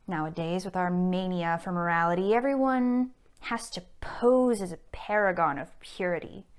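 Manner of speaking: arch, menacing society blackmailer; Mrs Cheveley. Acted. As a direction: toy with your victim, then threaten scandal. Performs Mrs Cheveley's monologue.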